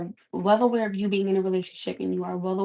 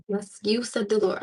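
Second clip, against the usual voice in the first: normal talking voice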